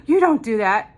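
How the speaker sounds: loud imitated scolding disbelief; mock shock. Friends reacting to her cancer worry, loud mock shock. Acts out people's shocked reply.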